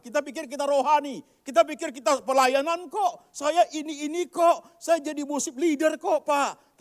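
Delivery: loud, high, smug churchgoer; mocking self-righteous voice. Preacher loudly mimicking a smug worship leader. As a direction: lampoon the proud believer.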